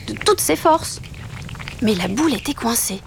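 ordinary delivery

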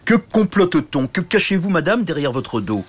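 bully the princess with questions; oafish knight demanding. Acted. Grand chevalier interroge la princesse. (grand chevalier stupide qui interroge)

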